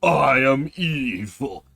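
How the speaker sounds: slow, loud, booming evil villain; evil demon voice. Retold or imitated. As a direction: drag it out like a cartoon demon. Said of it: "Amon is just".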